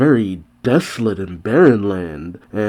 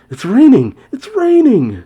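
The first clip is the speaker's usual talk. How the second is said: much higher and louder, overjoyed cry; joyful shouts; 14 semitones higher, 7 dB louder than usual. Acted. Villagers cheering as the rain finally falls, shouting with joy. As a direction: shout it like rain after a long drought.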